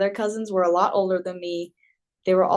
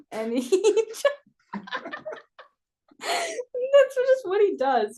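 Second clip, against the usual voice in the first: laughing; 13 semitones higher, slower than usual. Real, not acted. Laughing while telling story.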